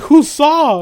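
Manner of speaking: high, loud anxious question; high, loud panic; panicked. Retold or imitated. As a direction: blurt it in alarm. Panicking over who witnessed it.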